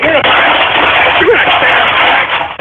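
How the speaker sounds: high, drawn-out strained scream; furious screaming. Real, not acted. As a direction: scream the warning until the voice tears. Host's tantrum at callers.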